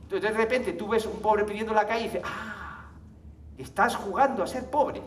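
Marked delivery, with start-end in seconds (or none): none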